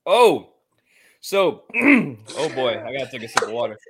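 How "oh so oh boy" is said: loud, strained gasp after spice; burning throat. Real, not acted. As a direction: choke out the words through the burn. Big sip of spicy drink.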